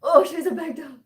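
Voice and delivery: high, loud delighted exclaim; excited. Real, not acted. Showing her big dog to the chat, excited and loud. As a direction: gush over the dog.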